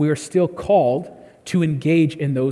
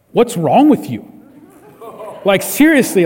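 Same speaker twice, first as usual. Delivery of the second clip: louder, blunt rebuke of a sinner; harsh scolding; 3 semitones higher, 5 dB louder than usual. Retold or imitated. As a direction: scold like someone with no grace. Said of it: "It's like".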